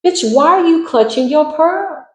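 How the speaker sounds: high, slow, drawn-out sassy put-on voice; mocking sass. Reader mocks the pearl-clutching intruder, sassy high drawl.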